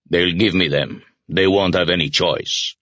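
mildly booming character voice; a touch of boom, without shouting. A narrator's reading. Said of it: "Hunter boomed".

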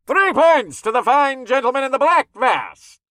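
high, booming showman's score call; ring announcer. A narrator's reading. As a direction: proclaim it to the whole arena. Announcer calls the score to audience.

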